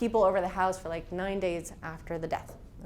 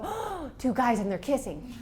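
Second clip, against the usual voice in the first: startled remark; 3 semitones higher than usual. Retold or imitated. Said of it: "see a couple of guys kissing and go".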